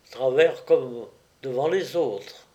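ordinary delivery, nothing marked

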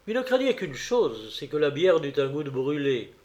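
ordinary delivery

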